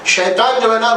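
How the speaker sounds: usual speaking voice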